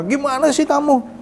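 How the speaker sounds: high, drawn-out exasperated scolding, acted out; retold anger. Husband scolds wife over the phone, re-enacted angry. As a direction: re-enact an indignant telling-off, pitch right up. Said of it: "saya marah".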